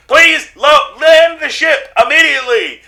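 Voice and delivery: loud, high-pitched begging; desperate plea. Acted. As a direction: shout it like a panicked officer begging. Police officer pleading over radio.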